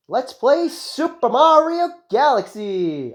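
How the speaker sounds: high, loud, drawn-out title announcement; title fanfare. Pretend. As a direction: announce the game big, slowly and excitedly. Reading out the game's title with fanfare.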